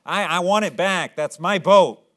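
put-on high, loud, excited child's voice; excited boy. Little boy to shopkeeper, spotting his lost boat, excited shout. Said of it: "He was all excited".

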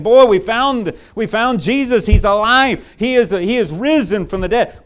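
high, loud, breathless good news; excited women. Retold or imitated. Women telling the apostles Jesus is alive, high and excited. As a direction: rush the news out joyfully. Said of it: "I think they're all excited".

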